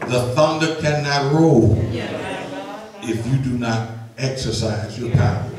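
steady, measured public prayer; earnest prayer. Acted. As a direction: pray aloud before the congregation, deliberate and sincere.

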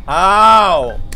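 loud, long, baffled "how"; exasperated yell. Real, not acted. Player asking the game for answers, exasperated. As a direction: cry out in disbelief.

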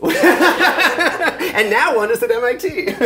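laughing. Real, not acted. Laughing at her own punchline.

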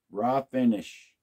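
usual speaking voice

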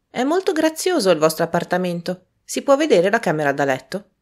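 teasing Prudence. A narrator's reading. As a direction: needle him with a cheeky jab. Prudence voiced, nosy.

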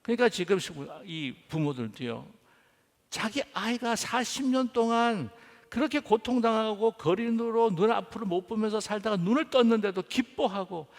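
ordinary talk, nothing marked

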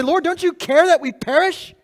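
high, urgent plea to the Lord; frightened plea. Retold or imitated. Disciples waking Jesus in the storm, high frightened plea. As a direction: beg him to wake up, voice pitched high.

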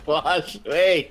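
laughing. Real, not acted. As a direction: say it while cracking up. Laughing through the repeat.

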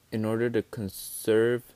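ordinary delivery

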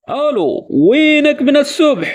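anxious, calling into the phone; worried friend who vanished since morning. Shown as a demonstration. Caller on the phone to a missing friend, worried. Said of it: "تھوڑا پریشان ہو کر بولیں گے".

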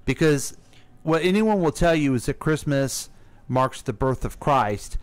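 ordinary talk

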